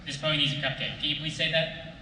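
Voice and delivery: ordinary talk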